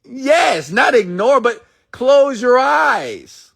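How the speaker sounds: very high, slow, drawn out; high intense urging. Acted. Speaker insists listeners close their eyes, very high and slow. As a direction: plead gently but intensely.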